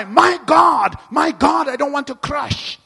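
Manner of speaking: high, loud panicked shout; panicked cry. Pretend. Preacher acting out a driver losing control, shouting in panic. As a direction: cry out as the car spins. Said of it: "you cry".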